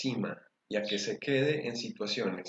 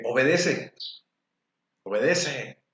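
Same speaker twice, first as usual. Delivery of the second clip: stern parent command; 3 semitones higher, 4 dB louder, slower than usual. Retold or imitated. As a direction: bark the order at a child like a strict parent. Parent ordering a child to obey.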